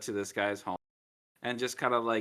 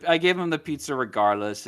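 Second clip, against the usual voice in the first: ordinary talk, nothing marked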